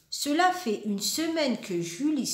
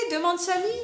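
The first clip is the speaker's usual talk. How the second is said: ordinary delivery, nothing marked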